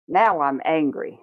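ordinary delivery

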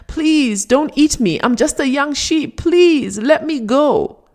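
high, trembling plea for mercy; fearful pleading. A narrator's reading. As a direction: beg like a terrified cub. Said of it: "shook in fear and said".

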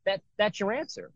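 teacher pretending to be stunned by the student's reply; mock surprise. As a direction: feign amazement at the easy answer. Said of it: "I'm like".

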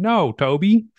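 high, loud imitated American voice; American accent. Client answering her coach, imitated high American accent. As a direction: exaggerate an American woman's swooping intonation. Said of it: "You know, American voice".

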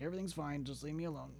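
very soft, strained mock kid voice; imitating straining child. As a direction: act out a child quietly straining to hide it. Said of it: "that fucking real concentrated face".

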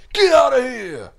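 long, high, very loud rapper scream; imitated scream. Showing how the rapper screams, loud drawn-out scream. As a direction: scream it out and stretch it. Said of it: "just the way he screams".

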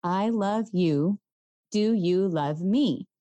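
slow, spaced-out words; slow squeeze pattern. Shown as a demonstration. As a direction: say each word as if squeezing a hand. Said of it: "The pattern is".

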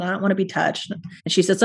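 ordinary talk, nothing marked

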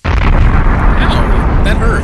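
loud, high hammed-up yelp of pain; mock pain. Cohost fakes being blown up by a toy, with a loud mock yelp of pain. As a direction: howl as if the toy blast really hit you. Cohost pretends to blow him up.

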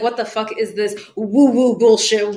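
a hint of an incredulous scoff, laughing by the end; mock skeptic scoff. Imitating her old skeptical self to the host, mock scoffing. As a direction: scoff at the woo-woo idea, half laughing. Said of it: "I was the girl that is like".

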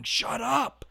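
sharp high yell; retold kid yelling. A kid yelling at his classmate in class, high sharp shout. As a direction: snap it out like a rude kid shouting.

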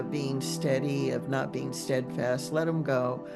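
usual speaking voice

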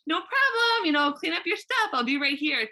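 high, sugary, overly polite; mock a too-sweet reassurance; fake-sweet high voice. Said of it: "the energy has completely shifted to, like".

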